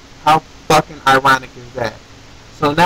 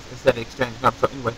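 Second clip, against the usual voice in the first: usual speaking voice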